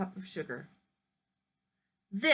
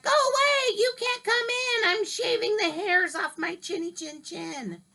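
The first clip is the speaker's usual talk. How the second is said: much higher, loud yell back; pig yelling; 10 semitones higher, 4 dB louder than usual. Acted. Second pig yelling at the wolf through the door, high yell. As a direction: shout him away from the door. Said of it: "He yelled back".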